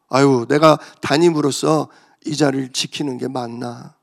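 ordinary talk

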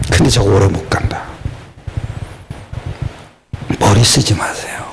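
hushed, breathy warning to the congregation; whisper. Acted. Preacher whispering a warning to the congregation. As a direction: lean in and whisper it low.

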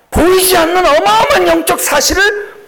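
high, loud preaching cry; preaching at full force. Acted. Pastor to congregation, crying out in full preaching force. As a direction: lift the congregation with a ringing cry. Preacher shouting his climax.

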